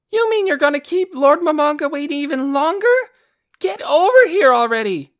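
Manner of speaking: put-on high, very loud angry girl; angry yelling. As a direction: yell at him to hurry. Aura scolding her brother.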